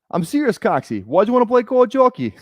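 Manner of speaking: hammed-up Boston hockey player; Boston accent. Streamer acting Miracle bar scene, challenging teammate, Boston accent. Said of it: "that's my Miracle Boston".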